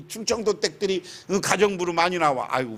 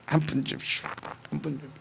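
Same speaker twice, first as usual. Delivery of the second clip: beggar's plea; 5 semitones lower, 9 dB quieter, faster than usual. Retold or imitated. Preacher voices begging blind man.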